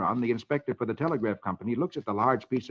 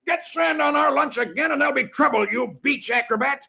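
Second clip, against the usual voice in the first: angry shout; 11 semitones higher than usual. Acted. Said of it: "The man leaps to his feet and shouts".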